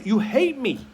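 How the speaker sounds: put-on child shouting back at the parent; angry kid. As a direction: hurl it like a sulking teen. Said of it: "they get angry and say".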